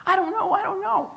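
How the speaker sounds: high, loud flustered protest; retold fluster. Mother fends off girlfriends' questions, flustered mock yelp. Fending off friends' many questions.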